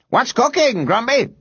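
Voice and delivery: high, teasing neighbour's call. A narrator's reading. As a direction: call out across the street. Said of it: "someone called out".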